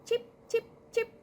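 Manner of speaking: high, short chipping sounds; evenly spaced high chirped taps; imitated noise. Cat hears a noise in the kitchen, imitated chipping. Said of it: "he starts hearing this noise".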